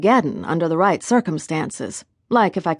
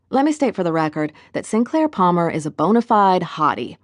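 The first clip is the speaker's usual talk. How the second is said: usual speaking voice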